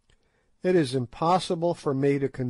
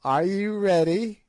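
piping witch; 5 semitones higher than usual. A narrator's reading. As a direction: pipe it out like an ancient sly witch. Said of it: "she piped".

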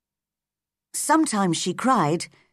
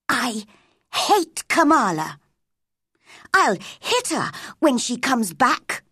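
put-on high, cross child's grumble; petulant, pitched-up anger; 4 semitones higher than usual. A narrator's reading. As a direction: vent crossly to yourself. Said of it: "saying crossly to herself".

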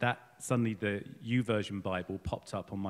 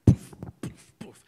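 poof sound effects; 10 dB louder, slower than usual. Retold or imitated. Armor clamping on, sound effect.

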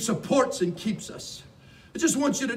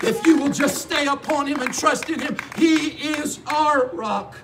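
high, loud pulpit shout; full-force preaching; 6 semitones higher, 6 dB louder than usual. Real, not acted.

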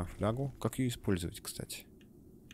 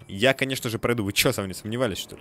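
cocky boast; 3 semitones higher, 7 dB louder than usual. Real, not acted. Boasting he'll make the jump.

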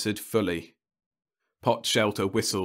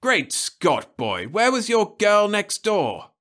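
higher, louder indignant scolding; angry; 13 semitones higher, 5 dB louder, slower than usual. Acted. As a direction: scold him, finger raised. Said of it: "shaking an angry finger".

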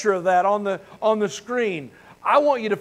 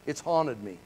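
quiet repeat; 7 semitones lower, 10 dB quieter than usual. Real, not acted. Pastor echoing his regret to the church, hushed. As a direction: drop much softer and lower on the echo.

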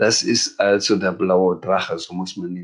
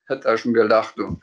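ordinary delivery

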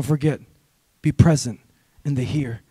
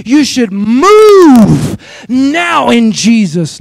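drawn-out, louder revival preacher push; Stephen Furtick; 6 dB louder, much slower than usual. Acted. Pastor imitating Stephen Furtick, stretched and loud. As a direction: stretch it like a famous megachurch preacher. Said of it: "I just sounded like Stephen Furtick".

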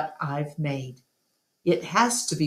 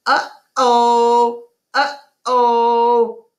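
loud, high parrot squawk; loud, high macaw mimicking a phrase; 7 semitones higher, 6 dB louder, much slower than usual. A narrator's reading. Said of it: "practicing a new phrase".

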